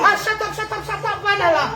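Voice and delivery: high, sharp shut-up at the toy; snapping mother. Acted. Mother snaps at other toy.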